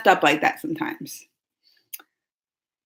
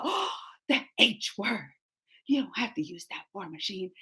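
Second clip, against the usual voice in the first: prim mom voice; 6 semitones lower, 13 dB quieter than usual. Retold or imitated. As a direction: hush it like a prim lady. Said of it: "She was all".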